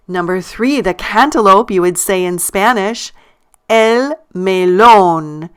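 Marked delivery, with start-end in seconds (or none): 3.7-5.5 "el melón" very slowly, long stressed LÓN; stressed syllable. Shown as a demonstration. Teacher showing the underlined syllable, slow stressed "LÓN" in "melón". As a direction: stretch out "el melón", leaning on the final LÓN. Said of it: "notice I stress the syllable".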